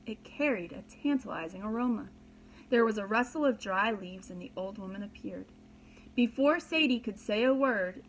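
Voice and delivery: ordinary delivery, nothing marked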